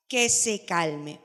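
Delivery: "Que se calme" dicho muy lento; recálcalo despacio; lento y claro. Shown as a demonstration. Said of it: "¿Qué buscamos?". (respuesta enfatizada despacio)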